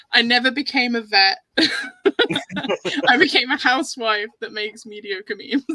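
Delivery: talking through real laughter; words broken up by bursts of laughing; amused confession, laughing between phrases. Real, not acted.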